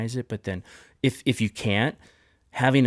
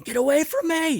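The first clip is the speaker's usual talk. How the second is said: mimicking a son's high-pitched scream of get away; a son's shrieking yell, imitated by the dad; retold high scream. Dad retelling his son screaming at him, high mock yell. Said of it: "He was screaming".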